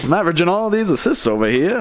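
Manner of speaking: put-on high, quick old-timey 1920s voice. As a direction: rattle it off like a twenties radio man. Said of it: "that's my nineteen twenties voice".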